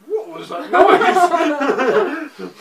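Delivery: high, slow, laughing. Real, not acted. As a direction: crack up mid-question. Laughing at noise.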